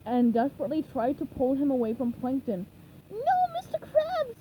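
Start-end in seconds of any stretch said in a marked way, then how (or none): 3.1-4.4 very high, pleading cry; desperate cry. A narrator's reading. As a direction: beg him to stop. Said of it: "he cried".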